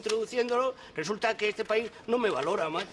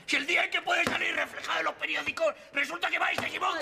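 sube la voz, rápido y dolido; arrebato indignado; 6 semitones higher, faster than usual. Acted. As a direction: grita tu indignación herida. Se indigna por el crucigrama. (indignado, gritando deprisa)

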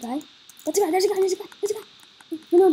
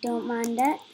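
normal talking voice

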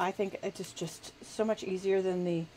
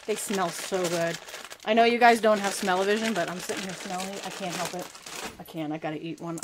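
usual speaking voice

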